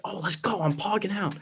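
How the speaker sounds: hammed-up giddy reader; mock excitement. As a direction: play up your past self's glee. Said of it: "So I was like".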